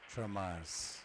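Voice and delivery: ordinary talk, nothing marked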